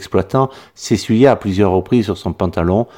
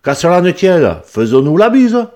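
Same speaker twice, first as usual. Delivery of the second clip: voix haute et forte du Midi, jovial; l'exploitant provençal insiste, plus haut; fort accent méridional; 10 semitones higher, 5 dB louder than usual. A narrator's reading. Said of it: "avec un fort accent méridional". (paysan du Midi enjoué et sonore)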